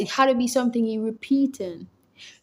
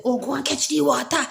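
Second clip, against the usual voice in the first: retold scolding; 4 semitones higher, 4 dB louder than usual. Retells woman scolding her husband.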